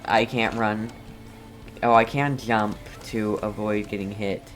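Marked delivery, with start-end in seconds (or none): none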